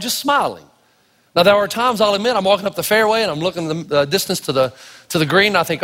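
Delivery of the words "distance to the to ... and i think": ordinary delivery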